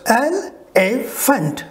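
Elephant very slow, three separate strokes el, e, phant; syllable by syllable. Shown as a demonstration. Teacher showing the three strokes, very slow and segmented. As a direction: break Elephant into three spaced beats. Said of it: "Three strokes".

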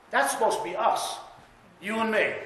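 ordinary delivery

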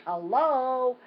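high, loud sarcastic hello; grandson's hello, as if to say finally; mock duh hello. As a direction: pitch it like an obvious-answer duh. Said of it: "you can just see the expression".

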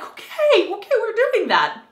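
high, loud, laughing okay; laughing disbelief. Retold or imitated. Viewer reacting to the gory scene, laughing disbelief. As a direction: laugh it off in shock. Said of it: "I was like".

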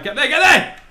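loud high push; urging shout. Real, not acted. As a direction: yell it on like a fan. Urging ball over obstacle.

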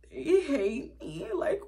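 high, whiny mock sobbing with laughs; hammed-up crying self, half laughing; imitating her younger self wailing to her sisters; mock bawling. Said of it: "bawling my eyes out".

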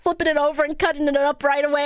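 ordinary delivery

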